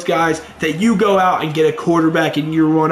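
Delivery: normal talking voice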